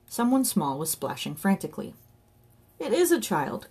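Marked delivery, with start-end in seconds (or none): 2.8-3.7 higher-pitched cry of alarm; raised, alarmed voice. A narrator's reading. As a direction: cry out in sudden realisation. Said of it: "cried Johnny".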